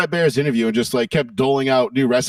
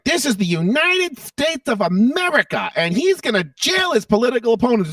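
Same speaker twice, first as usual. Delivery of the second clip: wag a finger at the crowd; finger-pointing Harris; 6 semitones higher than usual. Retold or imitated. Mocking Harris pointing fingers, mock-indignant. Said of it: "the finger pointing and, like".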